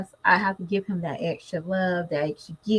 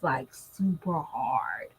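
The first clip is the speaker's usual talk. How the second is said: whispered, low and slow; 7 semitones lower, 8 dB quieter, much slower than usual. Real, not acted. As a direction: drop to a breathy whisper. Whispered aside.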